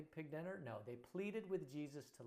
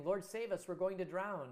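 panicked plea; 6 semitones higher, 10 dB louder than usual. Acted. Disciples cry out in storm.

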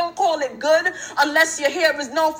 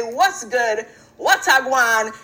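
low, loose 'What's good? What's agwan?' in slang register; street greeting; 6 semitones lower, slower than usual. Shown as a demonstration. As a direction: drop into casual street talk for 'What's good?'. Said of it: "I can say".

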